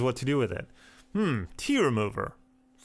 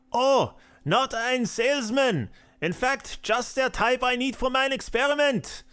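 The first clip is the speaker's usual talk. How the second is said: put-on mad scientist German accent; 12 semitones higher than usual. Mad scientist shooing a salesman away, high German accent. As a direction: shriek it like a crazed German scientist. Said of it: "in my terrible German accent".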